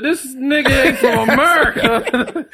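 slow, high exclamation through laughter; retold astonishment. Friend recalling their first meeting, laughing mock disbelief. Retelling his reaction to the accent.

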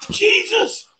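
high-pitched startled exclamation; mock alarm. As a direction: yelp it like a panicked shopper. Mimics shoppers alarmed by cough.

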